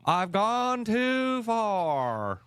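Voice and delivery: high, slow, drawn-out put-on voice mocking the coach; mocking the coach's fake accent, high and slow; Hillary Clinton impression. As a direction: stretch each word out high and slow like a bad impersonation. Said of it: "He did his Hillary Clinton".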